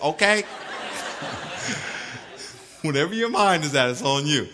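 ordinary delivery

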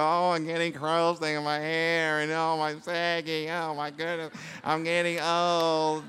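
mock lament. Imitating someone aging in the mirror, mock lament. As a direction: whine lightly about aging. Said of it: "you go".

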